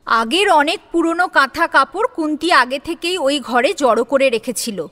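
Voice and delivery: ordinary delivery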